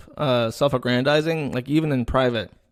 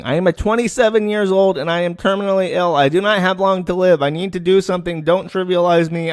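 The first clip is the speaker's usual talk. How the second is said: higher and louder, read as shouting; all-caps yell; 6 semitones higher, 4 dB louder than usual. Retold or imitated. Chloe's all-caps messages to Brianna Wu, read as a loud yell. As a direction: blast it out like all-caps text. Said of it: "Chloe's now all caps typing".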